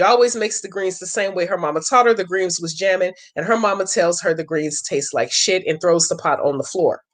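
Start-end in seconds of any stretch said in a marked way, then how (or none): none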